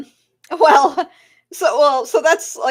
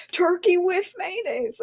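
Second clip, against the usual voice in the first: exasperated insisting; 9 semitones higher, slower than usual. Retold or imitated. Customer insisting, pitched up.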